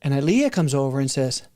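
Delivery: normal talking voice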